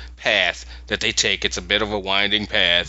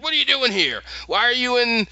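raised, higher voice calling across a room; mock yell across the room; 8 semitones higher than usual. Goblin and hobgoblin yelling across the room, in a raised, much higher mock yell. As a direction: goblin challenging an intruder, pitched up high. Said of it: "yell at each other".

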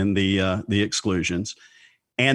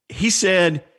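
witness voice, pitched up and louder; high mock witness voice; 8 semitones higher, 4 dB louder than usual. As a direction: play the indignant witness. Witness quoted in mock courtroom.

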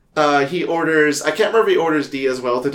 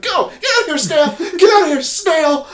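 high, loud hammed-up shout; mock yell; 4 dB louder than usual. Charlie shouting at Gail while throwing salt, high and loud. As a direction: shriek her out of the room.

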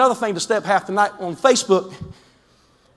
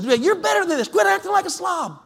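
mock scolding shout; 8 semitones higher than usual. Acted scolding of messy child.

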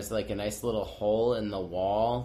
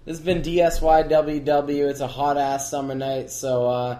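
announcer sign-off; 4 semitones higher, 9 dB louder than usual. Acted. Loud podcast sign-off.

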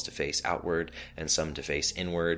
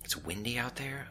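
musing whisper, higher, to himself; puzzled whisper; 8 semitones higher, 6 dB quieter than usual. A narrator's reading. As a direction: whisper it, a bemused question to himself. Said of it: "Obi said out loud to himself".

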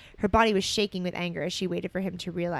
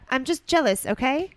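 outburst; 6 semitones higher, 6 dB louder than usual. Acted. Ron confessing.

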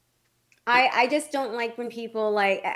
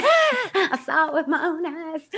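high, slow Southern drawl breaking into laughter; Southern accent, laughing; 7 semitones higher, slower than usual. Retold or imitated. Retelling the caller bit, laughing Southern accent. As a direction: ham up the Southern witness through giggles. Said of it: "with that accent".

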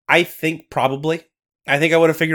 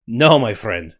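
louder Scottish storyteller voice; Scottish accent; 4 dB louder than usual. Acted. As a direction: narrate like a Highland storyteller. Nessie narration.

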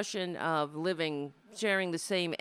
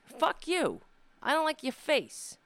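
higher, louder retort; snappy comeback; 9 semitones higher, 4 dB louder than usual. Retold or imitated. Host retorting to critics who dislike her voice, higher and louder. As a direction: throw it back in the critic's face.

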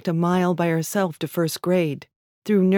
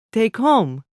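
put-on halting immigrant accent, a little higher and louder; short phrase in heavily accented English; thick foreign accent; 6 semitones higher, 4 dB louder than usual. A narrator's reading. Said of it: "his accent so thick".

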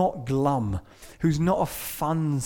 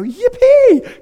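much higher and louder whoop; yippee; 11 dB louder than usual. Retold or imitated. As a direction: cheer like a pardoned sinner. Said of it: "And you go".

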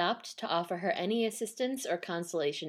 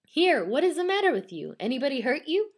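raised, brusque demand; rough questioning; 6 semitones higher, 4 dB louder, faster than usual. A narrator's reading. Soba man to screaming stranger, brusque. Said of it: "roughly exclaimed the soba man".

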